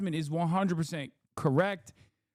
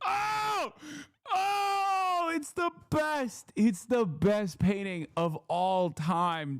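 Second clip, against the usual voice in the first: much higher, yelling with delight; excited shout; 6 semitones higher, slower than usual. Real, not acted. Streamer shouting excitedly about the painting. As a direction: yell it in pure excitement.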